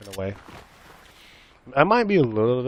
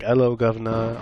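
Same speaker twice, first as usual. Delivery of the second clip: mock British greeting, a bit louder; British accent; 5 dB louder than usual. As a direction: imitate a British person saying hello. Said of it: "like a British person".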